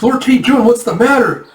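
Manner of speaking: high, loud worried cry; startled concern, pitched well up; alarmed attendant. Acted. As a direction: call out in a fluster to your master. Soldiers alarmed by his scream.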